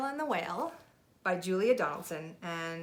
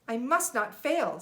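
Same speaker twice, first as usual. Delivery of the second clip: determined snail; 6 semitones higher, 6 dB louder, slower than usual. Acted. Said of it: "said the tiny snail".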